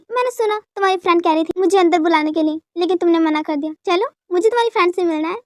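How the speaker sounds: normal talking voice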